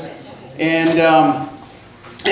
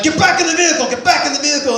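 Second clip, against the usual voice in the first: pitched-up mock police shout; mock yelling cop; 8 semitones higher, faster than usual. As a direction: imitate a cop yelling orders at gunpoint. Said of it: "He's yelling".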